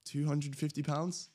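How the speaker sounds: name the price as if asking permission; timid slow offer of a price; unsure price. Retold or imitated. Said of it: "And I'm like".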